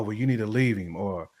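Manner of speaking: usual speaking voice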